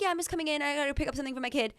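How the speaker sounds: high, casual mock-innocent voice; mom acting innocent. Daughter imitating her mom talking her way into school, high innocent voice. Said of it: "My mom was like".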